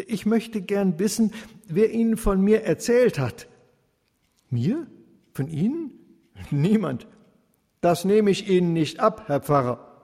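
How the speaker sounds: laut und höher, anklagend; misstrauisch fordernd. A narrator's reading. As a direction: den Pfarrer scharf zur Rede stellen. Sauter stellt den Pfarrer zur Rede. (Sauter verlangt vom Pfarrer misstrauisch eine Antwort)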